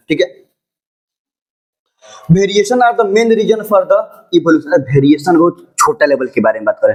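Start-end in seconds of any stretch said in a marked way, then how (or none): 2.3-4.7 slow dictation. Acted. Teacher dictating notes to the class, slow and deliberate. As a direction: read out word by word, very slowly.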